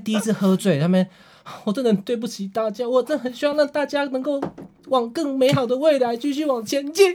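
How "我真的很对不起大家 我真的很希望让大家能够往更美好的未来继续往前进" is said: mock humble apology. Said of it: "就开始哭呢".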